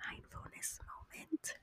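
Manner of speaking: slow, hushed whisper. Acted. Introducing the mindfulness moment to viewers, in a slow whisper. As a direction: breathe the words out like a secret.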